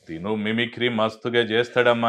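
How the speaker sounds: loud, lightly acted talking-up; retold introducer. Someone pushes him on stage as a mimic, retold loudly. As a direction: imitate someone loudly volunteering a friend. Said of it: "అని చెప్పి నన్ను stage ఎక్కించారు".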